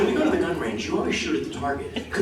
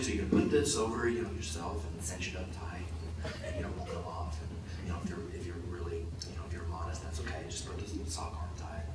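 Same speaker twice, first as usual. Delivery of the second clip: much softer, half-whispered costume lady; hushed costumier; 11 semitones lower, 14 dB quieter than usual. Retold or imitated. Costumier hands him the sock, softly whispering. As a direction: coax him gently under her breath, near whisper. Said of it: "She's like".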